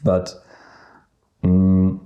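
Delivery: ordinary delivery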